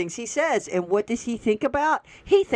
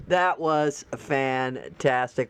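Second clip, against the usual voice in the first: lower, slow, drawn-out That was fantastic; slow savoring; 6 semitones lower, much slower than usual. Real, not acted. Praising the fixed post, savoring it slowly. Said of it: "repeated slow savoring".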